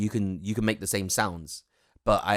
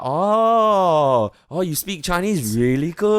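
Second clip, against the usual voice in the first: much higher, louder gushing Oh, oh; impressed customer; 9 semitones higher, 7 dB louder, slower than usual. Retold or imitated. Voicing an impressed Chinese customer, gushing and high. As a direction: gush like an impressed stranger. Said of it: "we're like".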